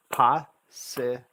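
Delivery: slow "possible" in three detached pieces, pos, si, ble; separated syllables. Shown as a demonstration. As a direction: break possible apart, loud first beat. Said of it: "you have to hear the syllable sounds separately".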